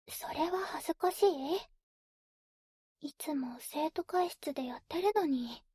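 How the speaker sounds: breathy whisper into the ear. Acted. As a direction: whisper it playfully, close up.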